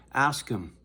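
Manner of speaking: ordinary talk, nothing marked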